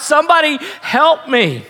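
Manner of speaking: very high, pleading preacher's cry; acted high cry, falling at the end; crying out for help. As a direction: voice a desperate plea from the pulpit. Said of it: "they're just crying out".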